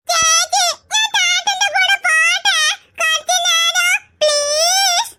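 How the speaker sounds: put-on high, sweet toddler pleading to go; little child voice. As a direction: sound like a small kid asking his sister.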